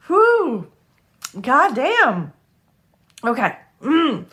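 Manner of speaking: high, loud cry of release, blowing off emotion; overwhelmed exclamation. Real, not acted. Tarot reader to viewers, overwhelmed, high loud outburst. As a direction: let it burst out high and loud to shake off the tears.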